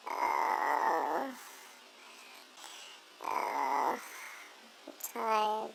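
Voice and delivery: soft, drowsy, fading murmur; nodding off as you speak; sleepy. Real, not acted. Drowsy after warm bath.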